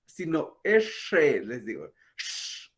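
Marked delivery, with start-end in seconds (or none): 2.2-2.7 whispered "sh", breathy and unvoiced; soft sh sound. Shown as a demonstration. Teacher modelling the Kaqchikel r as sh. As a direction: breathe out a quiet "sh". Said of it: "ese es el sonido".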